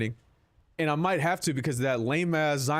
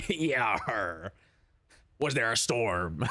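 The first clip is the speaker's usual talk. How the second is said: pirate voice; 3 semitones lower, much slower than usual. Retold or imitated. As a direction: ham it up like an old sea pirate. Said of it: "like a pirate".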